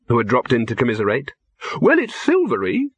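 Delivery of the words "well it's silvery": hesitant, much higher-pitched doubt; high, uncertain character voice; doubtful. A narrator's reading. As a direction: sound unsure of your own result, pitch lifted high. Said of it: "said Silverfish doubtfully".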